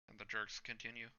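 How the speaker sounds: ordinary delivery, nothing marked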